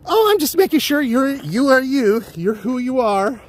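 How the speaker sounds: high squeaky mock fan, fumbling; starstruck fan. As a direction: imitate the gushing lady checking he's real. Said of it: "his one lady grabbed him and go".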